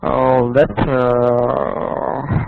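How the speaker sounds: ordinary delivery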